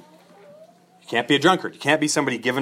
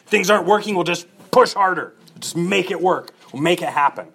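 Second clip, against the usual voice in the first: mock overbearing boss, pitched higher and louder; pushy leader; 6 semitones higher, 4 dB louder than usual. An overbearing leader insisting on pushing harder, mock forceful. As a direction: play the bully who forces his way.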